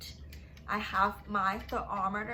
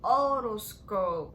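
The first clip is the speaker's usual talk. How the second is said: otoscope drawn out, louder; emphatic drawn-out naming; slow clear answer; 5 dB louder, slower than usual. Shown as a demonstration. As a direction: spell it out slowly for kids. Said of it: "teacher gives the answer".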